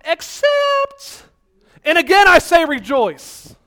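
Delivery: a high, sneering voice that turns slow and loud; mock the verse like a cynic in the back pew; sarcastic skeptic. Cynic at the back of the church mocking the verse, sarcastic and high. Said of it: "sarcastic, cynical, skeptical person".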